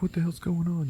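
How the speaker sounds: high, softened bewildered voice; acted daze. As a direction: ask as if dazed after a crash. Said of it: "like, all dazed".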